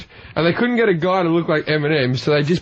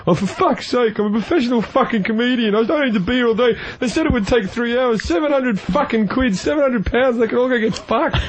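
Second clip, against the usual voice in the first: higher, ranting mock hissy fit; hammed-up tantrum; 7 semitones higher than usual. Retelling his porn-channel hissy fit to the hosts, mock ranting. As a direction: replay the diva tantrum over the long day. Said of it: "had a little temper tantrum and just went".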